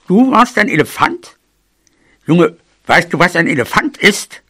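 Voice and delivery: put-on granny, doubting; scoffing mouse granny. A narrator's reading. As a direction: question the youngster in disbelief. Grandmother scoffs at the claim.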